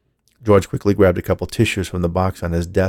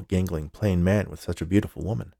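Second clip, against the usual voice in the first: ordinary delivery, nothing marked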